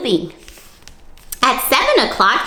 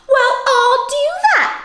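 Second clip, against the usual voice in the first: much higher, slightly louder screech; 4 dB louder than usual. Acted. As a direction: squeal it like a huffy bug. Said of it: "screeched the grouchy ladybug".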